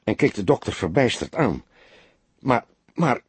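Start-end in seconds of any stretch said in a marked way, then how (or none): 2.4-3.2 stammering. A narrator's reading. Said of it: "stamelde hij eerst".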